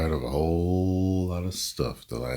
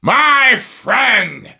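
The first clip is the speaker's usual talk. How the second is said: booming, drawn-out ring announcer, much higher and louder; wrestling promo announcer; 13 dB louder, much slower than usual. Acted. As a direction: stretch every word like hyping a main event. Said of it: "He could have been helping promote".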